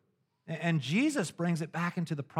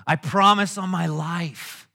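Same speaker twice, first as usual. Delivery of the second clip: mock solemn promise; 3 semitones higher, 6 dB louder than usual. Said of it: "he's serious now".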